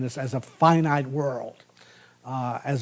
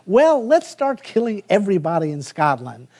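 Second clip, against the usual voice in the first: a hint of a giddy tyrant, pitched higher; mock tyrant Macbeth; 6 semitones higher, 4 dB louder than usual. Lecturer lampoons Macbeth's murderous logic for class, mock tyrant. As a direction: toss it off like a cheerful madman. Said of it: "what drives him again and again".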